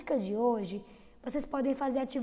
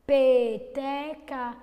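Peteca slowly and louder, stressing the P; drawn-out demo word; 7 dB louder, much slower than usual. Shown as a demonstration. Teacher repeating Peteca to isolate the P sound. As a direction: draw out Peteca so the P stands out. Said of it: "O som inicial foi o P".

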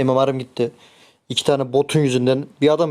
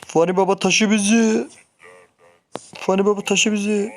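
excited cheering; 7 semitones higher than usual. Real, not acted. Cheering teammate to carry them.

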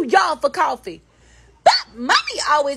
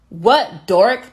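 usual speaking voice

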